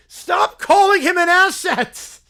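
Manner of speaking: loud, slow, fed-up yell; child yelling at the team leader; exasperated yell. Acted. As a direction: shout it like you've had enough.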